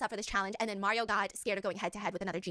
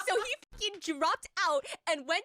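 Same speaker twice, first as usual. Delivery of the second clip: slow, laughing disbelief; 8 semitones higher, 4 dB louder, slower than usual. Real, not acted. Telling her friend about Mario's choice, laughing. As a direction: laugh through the punchline.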